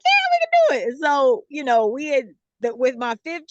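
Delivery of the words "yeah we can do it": high, loud eager yes; hammed-up confident husband; imitating the husband's eager agreement; mock husband. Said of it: "he was like".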